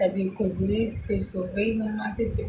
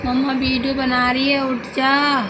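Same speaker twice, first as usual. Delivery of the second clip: louder, higher teasing wake-up call; calling out to wake him; 5 semitones higher, 4 dB louder than usual. Real, not acted. Mother calls out to her sleeping son to wake him up, loud and playful.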